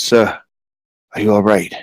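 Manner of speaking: low, soft check-in; concerned captain. Acted. Captain kneeling beside the hurt magistrate, quiet concern.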